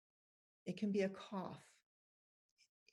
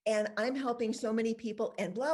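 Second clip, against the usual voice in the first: animated, gushing demonstration reply; effusive comeback; 3 semitones higher, 4 dB louder than usual. Shown as a demonstration. Models effusive comeback to judgmental ladies.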